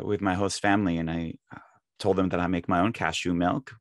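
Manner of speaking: usual speaking voice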